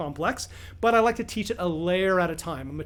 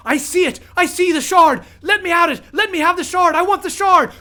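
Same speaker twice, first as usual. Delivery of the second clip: high, loud desperate screaming; a maddened prisoner howling with desperate craving; frenzied, craving scream; 12 semitones higher, 10 dB louder than usual. Acted. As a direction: shriek for the shard like a man possessed. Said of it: "frenzied, desperate, craving human voice".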